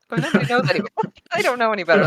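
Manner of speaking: high-pitched, through laughter; laughing. Real, not acted. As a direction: laugh your way through the excuse. Laughing at own long-standing mispronunciation.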